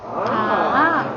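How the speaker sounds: AH dips down, then climbs back up; dipping third tone. Shown as a demonstration. Teacher models third tone for the class, falling then rising. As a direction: bend AH low and swing it up. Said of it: "go down and go up".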